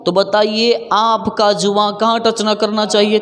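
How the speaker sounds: normal talking voice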